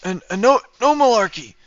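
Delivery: high, strained mock old-man voice; Biden impression. To chat, pushes the Biden impression further. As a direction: keep the bad Biden bit going. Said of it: "I can't do a Biden impression very well".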